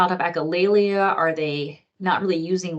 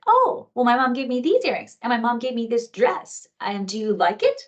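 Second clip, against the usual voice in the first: put-on much higher, chatty little-girl voice; 6 semitones higher than usual. Girl boasting about her dress, in a high little-girl voice. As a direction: show off like a proud four-year-old. Voicing the four-year-old girl.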